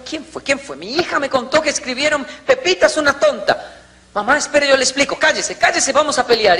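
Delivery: high, agitated complaining mother; angry mother. Retold or imitated. Mother at school over insult to her daughter, high and agitated. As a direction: storm into the school demanding answers.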